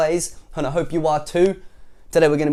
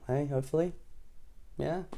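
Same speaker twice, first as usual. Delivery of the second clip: ordinary delivery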